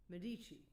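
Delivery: Medici said quickly as meh-DEE-chee; confirmed pronunciation. Shown as a demonstration. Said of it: "You said it perfectly".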